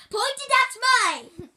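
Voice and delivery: sharp high yell to the cameraman; shouting at cameraman. Real, not acted. Kid turtle yelling at his cameraman to point the camera. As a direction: yell the correction at him.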